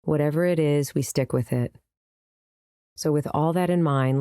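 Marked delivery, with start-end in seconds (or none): none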